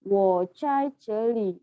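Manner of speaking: very slow, syllable by syllable; slow example. Shown as a demonstration. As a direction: spell out the Mandarin sentence slowly for learners. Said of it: "Contoh kalimat".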